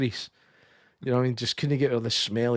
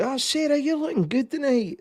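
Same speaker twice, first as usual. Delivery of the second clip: mock mirror rehearsal; 14 semitones higher than usual. Man practising on Sarah in the mirror, high mock-flirty voice. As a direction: rehearse a chat-up line in a put-on high voice.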